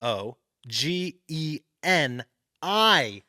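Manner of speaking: very slow, one letter at a time; slow spelling out. Real, not acted. Host spells it for the players, slow and letter by letter. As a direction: draw out each letter separately.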